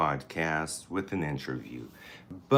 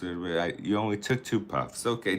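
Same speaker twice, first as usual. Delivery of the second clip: normal talking voice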